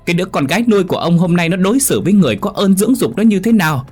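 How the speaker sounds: wailing high-pitched shouts to the absent husband, very fast; shrieking tirade. Acted. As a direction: scream the house down like an outraged stepmother. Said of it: "hét um trời".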